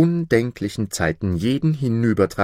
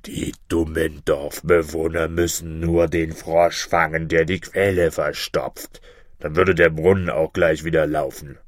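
low monster bird voice; deep, know-all creature; scornful griffin; 5 semitones lower than usual. Acted. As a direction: explain it with deep-voiced contempt. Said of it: "sagte der Vogel Greif".

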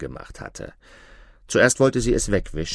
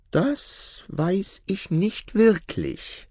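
sly tailor; 11 semitones higher, much slower than usual. A narrator's reading. Tailor voiced, slow and high.

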